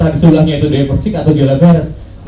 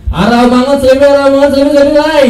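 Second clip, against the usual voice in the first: bus conductor call; 9 semitones higher than usual. Retold or imitated. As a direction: shout the stops like a Jakarta bus conductor. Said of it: "ya kondektur bis".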